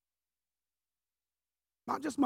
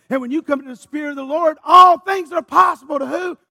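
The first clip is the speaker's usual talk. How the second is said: climbing from firm to a full preacher's shout, higher and louder; building sermon shout; 7 semitones higher, 11 dB louder than usual. Acted. Pastor rouses the congregation, rising to a shout.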